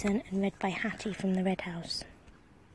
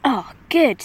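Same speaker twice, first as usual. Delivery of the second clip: pleased cook; 2 semitones higher, 9 dB louder than usual. A narrator's reading. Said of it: "said the cook".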